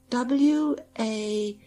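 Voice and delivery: slow spelling. A narrator's reading. Spelling out the word.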